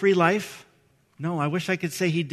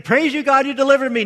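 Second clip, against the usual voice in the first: mock triumphant praise; 7 semitones higher, 7 dB louder, faster than usual. Imagined triumphant praise after rescue.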